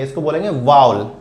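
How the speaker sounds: वावल said as "vaa-vul", two clear beats; correct model pronunciation. Shown as a demonstration. Teacher to students, modelling वावल. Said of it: "आज से आप वाव".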